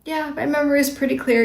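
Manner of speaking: steady, even, unbothered; calmly holding your ground, the right way. Shown as a demonstration. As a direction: state it like a simple fact, no heat. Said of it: "So just calmly say".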